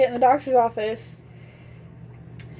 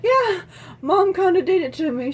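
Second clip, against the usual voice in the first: high, louder playful admission; imitated eager reply; 8 semitones higher, 4 dB louder than usual. Sheepish reply to doctor, retold.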